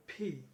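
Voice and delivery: ফি with air pushed out of the mouth; aspirated P. Shown as a demonstration. Teacher showing air must come out, aspirated P. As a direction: puff breath into ফি. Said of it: "মুখ দিয়ে বাতাস বেরোবে".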